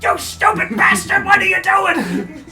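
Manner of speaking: enraged little creature; furious kobold. Acted. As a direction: scream down at the one who threw you. Kobold yelling from the mast.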